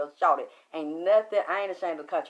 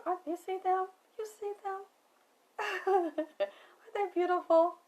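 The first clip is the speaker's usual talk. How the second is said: delighted cooing. Real, not acted. Cooing over pretty candles on screen.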